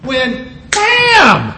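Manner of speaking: high bam!; preacher pointing at the signal word; emphatic bam. Shown as a demonstration. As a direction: snap the word out.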